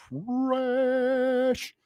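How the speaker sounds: trash stretched long and high; "trash" held out in a high mocking call; drawn-out trash. Shown as a demonstration. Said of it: "Say it with me".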